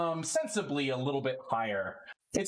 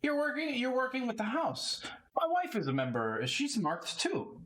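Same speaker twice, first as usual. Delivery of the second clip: Lot halfling voice; 12 semitones higher than usual. Acted. Lot reacts in voice.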